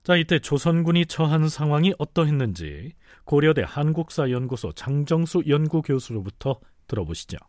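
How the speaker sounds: ordinary delivery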